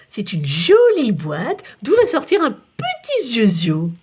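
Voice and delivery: put-on slow, sing-song talk as to a baby. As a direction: coo it like speaking to an infant. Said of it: "comme s'il parlait à un bébé". (le photographe parle à Geoffroy comme à un bébé)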